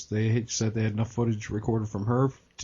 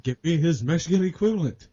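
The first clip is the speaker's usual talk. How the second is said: higher, put-on boss voice; barked order; 4 semitones higher, 3 dB louder than usual. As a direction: imitate a boss giving orders. Acted film boss's order.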